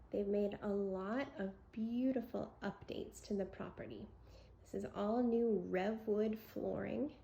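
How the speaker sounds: ordinary talk, nothing marked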